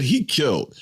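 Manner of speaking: normal talking voice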